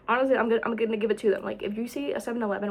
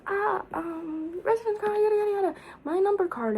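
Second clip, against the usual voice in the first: lightly high-pitched clerk voice; imitating clerk; 10 semitones higher than usual. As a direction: mimic the clerk's rattled answer high up. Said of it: "And she was like".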